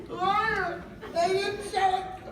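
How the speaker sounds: high, slow hammed-up wail; wailing to police. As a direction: stretch the words like a mock sob. Calling the lawyer wailing.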